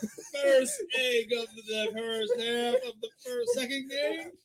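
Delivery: very high, drawn-out wail; excited commentator cry. Real, not acted. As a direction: call it in a squealing, slow cry. Commentator reacting to first score miss.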